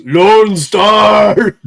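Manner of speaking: booming villain voice inside a helmet; Dark Helmet voice. Pretend. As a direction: boom it out like a masked space tyrant, high and loud. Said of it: "Dark Helmet voice".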